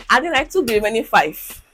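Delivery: usual speaking voice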